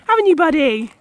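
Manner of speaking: high, fast coo to the pony; pet talk. Real, not acted. Cooing to the old pony.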